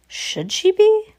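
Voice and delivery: high, slow spooky voice; eerie high voice. Acted. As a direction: stretch it out, ghostly and high.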